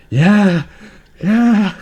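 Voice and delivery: high, loud, drawn-out "yeah"; emphatic retold yeah. Speaker answering partner who finally believes the stories, mock-exasperated shout. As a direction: exclaim each word way up high. Acting own reply to convinced partner.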